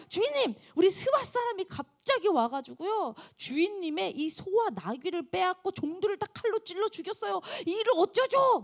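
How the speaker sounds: high, breathless bad news; panicked servant. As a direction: cry out the loss to your master. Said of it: "사환들이 와서 얘기를 해요". (높고 다급한 종의 보고)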